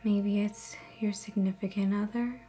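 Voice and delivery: soft, measured meditation voice with long pauses; guided meditation. Acted. Host guiding viewers through a relaxation visualization, guided meditation. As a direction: ease listeners into calm, phrase by phrase.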